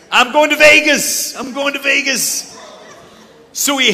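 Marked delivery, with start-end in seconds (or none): 0.1-1.4 loud, high, triumphant announcement; excited shout. Acted. The son who is leaving home tells his dad where he's going, with a loud, high excited shout. 1.4-2.6 high, laughing echo of the boast; laughing repeat. Acted. The son repeats his Vegas plan to the crowd, laughing as he says it.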